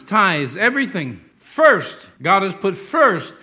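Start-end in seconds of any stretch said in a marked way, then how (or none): 1.4-3.3 FIRST high and loud, then slow; stress on first. Shown as a demonstration. Preacher to congregation, first hit hard. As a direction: punch first twice.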